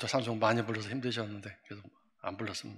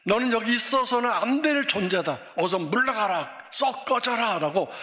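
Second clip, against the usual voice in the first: rebuking the wind; 8 semitones higher, 5 dB louder than usual. Retold or imitated. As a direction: thunder at the wind to be gone. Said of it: "그 바람을 혼내신 것입니다". (바람을 향해 크게 호통치듯)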